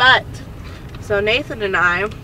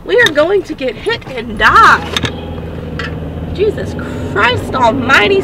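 real panic, much higher and louder; shouting in alarm at a reckless driver; panicked yelling; 9 semitones higher, 4 dB louder than usual. Real, not acted. Nearly hit in traffic.

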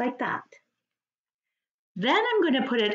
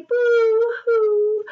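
high loud fake boo-hoo sob; mock crying; 10 semitones higher, 6 dB louder than usual. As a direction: wail a cartoon boo-hoo.

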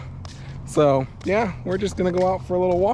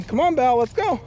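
high-pitched call to a pet; coaxing the dog; 9 semitones higher than usual. Real, not acted. Owner calls dog along on walk, high coaxing.

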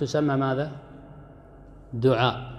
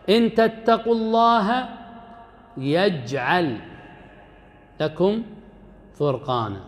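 Quran recitation; 3 semitones higher than usual. Shown as a demonstration. Teacher reciting an example verse to the class, chanted. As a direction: chant the verse, holding يجعل long. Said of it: "verse quoted in grammar lesson".

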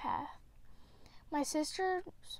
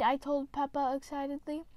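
ordinary delivery, nothing marked

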